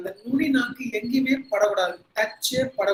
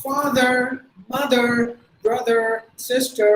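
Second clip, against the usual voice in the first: American R accent; 3 semitones higher, 4 dB louder, much slower than usual. Shown as a demonstration. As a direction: list the words with American rhotic R. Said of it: "They would say". (அமெரிக்க உச்சரிப்பில் R)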